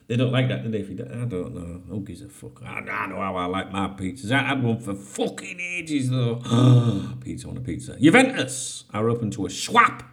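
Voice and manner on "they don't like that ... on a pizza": ordinary talk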